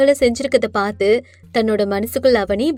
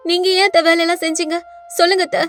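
higher, whiny mock-hurt pleading; fake pleading daughter-in-law; 5 semitones higher than usual. Avani to her mother-in-law, pleading with false hurt. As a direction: lay on the wounded innocence thick.

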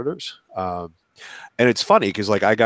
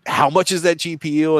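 sticker shock; 7 semitones higher, 5 dB louder than usual. Retold or imitated. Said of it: "it's like".

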